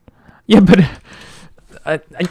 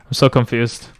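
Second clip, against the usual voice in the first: ordinary talk, nothing marked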